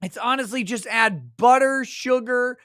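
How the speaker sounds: ordinary talk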